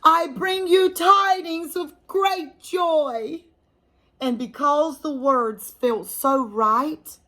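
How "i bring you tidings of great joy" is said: high, slow angel's announcement; joyful proclamation. Acted. Frances says her angel line, ringing proclamation. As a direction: proclaim it, full of wonder. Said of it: "she shouted".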